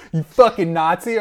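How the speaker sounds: loud, jeering insult; mock punk insult. Punks calling the old man a Nazi, loud jeering insult. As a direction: hurl it at the driver like a punk.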